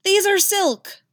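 loud, high, drawn-out wail; outraged yelp. A narrator's reading. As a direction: yelp in horror over ruined finery. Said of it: "then yelps as Geralt rips his trouser leg open".

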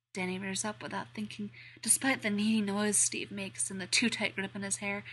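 usual speaking voice

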